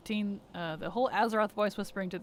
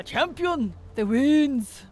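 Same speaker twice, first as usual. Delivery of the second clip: hammed-up, badly faked dwarf accent, raised voice; Magni accent attempt; 6 semitones higher, 7 dB louder, much slower than usual. Streamer imitating Magni crying out to the champion, badly faked accent. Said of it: "I don't do that accent very well".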